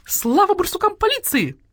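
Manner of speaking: very high squawking slogan; shrieked celebration; jubilant cheer. A narrator's reading. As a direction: cry it out like cheering hens. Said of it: "завопили куры".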